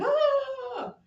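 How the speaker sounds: high, loud mock scream of a crowd; loud, high panicked cry. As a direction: yelp like startled sailors. Pirate attack, everybody screams.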